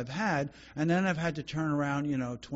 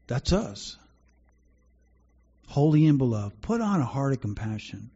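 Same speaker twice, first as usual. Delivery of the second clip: measured, solemn reading of the verses; preacher reading scripture to the congregation; 4 dB louder than usual. Acted. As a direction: read the Bible passage with weight and care. Reads Colossians aloud.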